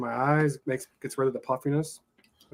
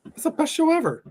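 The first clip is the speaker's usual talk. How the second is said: excited; 7 semitones higher than usual. Real, not acted. Gushing about Yellowstone.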